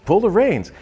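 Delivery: high, loud urgent advice; called instructions. Retold or imitated. Sister calling out instructions to panicked rider. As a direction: shout quick directions.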